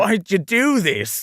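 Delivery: slow, high Australian wail; overdone Australian, pitched high; Australian accent. Acted. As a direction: drag it out in a hammy Aussie accent. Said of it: "using the accent again".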